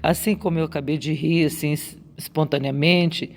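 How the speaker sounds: ordinary talk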